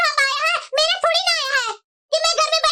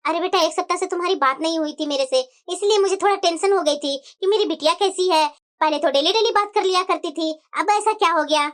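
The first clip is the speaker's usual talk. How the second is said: put-on lower, older mother's voice; worried mother on the phone; 9 semitones lower than usual. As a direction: sound like an anxious mother calling her daughter.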